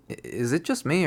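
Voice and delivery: usual speaking voice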